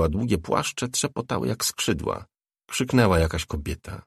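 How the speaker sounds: plain reading voice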